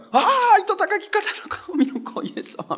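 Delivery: high, slow squealing demon, laughing; cowering demon. Acted. Pastor voices the demon surrendering to Jesus, high and slow. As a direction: play the demon groveling in mock terror.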